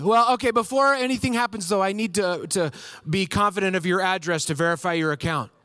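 a hint of a stubborn rep; insistent agent. Agent insisting on verifying the address, mock insistent. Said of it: "more impressions of DirecTV people".

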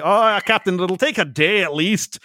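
put-on terrible Scottish accent. Engineer reassuring his captain, in a terrible Scottish accent. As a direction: play Scotty with a deliberately awful Scottish brogue. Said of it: "in a terrible Scottish accent".

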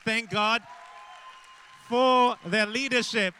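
loud, high exclamation over the crowd; shouted praise. Real, not acted. Preacher leading church in thanks for leaders: loud, high shout.

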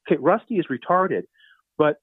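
ordinary talk

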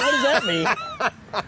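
laughing confusion. Retold or imitated. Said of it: "I'm like".

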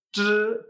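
TR as a buzzed retroflex without a puff of air; unaspirated zh. Shown as a demonstration. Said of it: "tengo que hacer eso, pero sacando aire".

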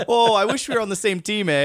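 put-on Canadian accent. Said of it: "Your Canadian accent is getting more suspect".

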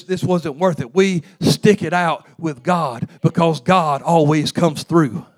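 stirring gospel preaching; rising preaching. Acted. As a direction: build the church up again. Preaching build again.

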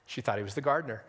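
usual speaking voice

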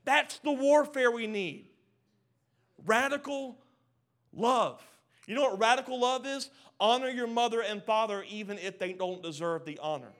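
loud, rising sermon build; full-force preaching. Acted. Preacher rallying the church against sickness, full force. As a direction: preach it out to the crowd, hammering each line.

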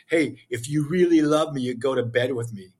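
usual speaking voice

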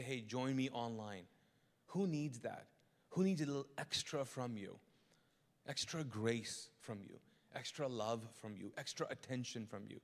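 low, quiet, searching questions; tender sermon appeal, softly; soft pleading appeal. Acted. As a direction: gently press each listener.

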